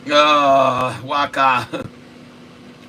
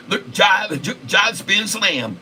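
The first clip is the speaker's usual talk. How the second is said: LeBron James impression; 6 semitones higher than usual. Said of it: "can you do LeBron James impression".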